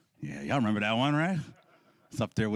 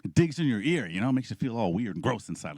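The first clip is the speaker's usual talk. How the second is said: ordinary talk, nothing marked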